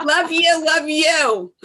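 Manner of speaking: shower them with love; gushing goodbye. Real, not acted.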